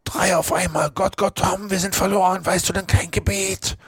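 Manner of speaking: very high, strained, panicked voice; terrified boy in a frantic stage whisper; shuddering whisper. A narrator's reading. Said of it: "Schaudernd flüsterte Huckleberry".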